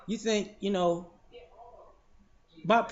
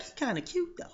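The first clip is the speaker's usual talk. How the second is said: put-on higher, soft girlish aside; girl's aside; 8 semitones higher, 5 dB quieter than usual. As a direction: murmur it to a friend behind him. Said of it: "you can hear her or her friends".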